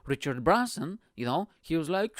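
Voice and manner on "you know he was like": normal talking voice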